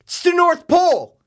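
high, loud mock scream; imitating a scream. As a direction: ham up the kid's shrill yell. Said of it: "And he screams it".

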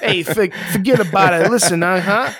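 hammed-up Italian tough-guy patter; Italian accent. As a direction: put on a playful Italian wise-guy accent. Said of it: "Italian accent right there".

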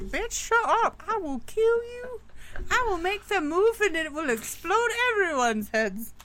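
high mock threatening voice; menacing guard voice. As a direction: shriek threats like a crazed guard. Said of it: "the guards were like".

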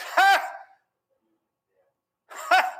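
high, loud isolated "ha"; smug mock laugh. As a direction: imitate a smug scoffer's short laugh. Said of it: "Just smug".

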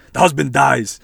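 loud, jokey spoiler announcement; joking blurt. Pretend. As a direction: blurt it out loudly for a laugh. Blurts the spoiler again, laughs.

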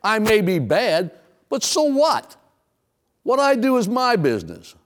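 high-pitched, brazen mock indifference; careless hedonist. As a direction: shrug it off defiantly as the hedonist. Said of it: "The hedonist says".

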